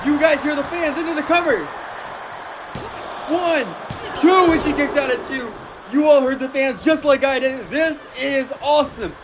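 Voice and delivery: high, shouting hype call; wrestling commentator. Acted. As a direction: scream the big spot to the crowd.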